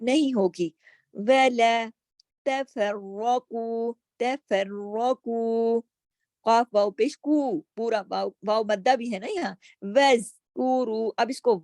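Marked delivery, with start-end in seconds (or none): 1.1-5.8 تَفَرَّقُوا with a full, heavy ر; heavy ra recitation. Shown as a demonstration. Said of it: "light letter کو پڑھنے کے لیے". 9.8-11.1 وَاذْکُرُوا with a thick ر; heavy ra. Shown as a demonstration. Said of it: "را موٹا پڑھا جائے گا".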